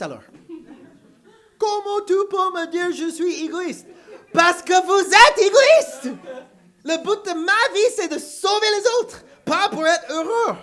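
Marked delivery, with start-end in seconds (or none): 1.6-8.7 heated retort. Retold or imitated. Preacher acts out a heated exchange.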